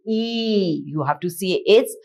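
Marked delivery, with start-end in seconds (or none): 0.1-0.8 E stretched into "eee"; long E sound. Shown as a demonstration. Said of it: "the sound expands to".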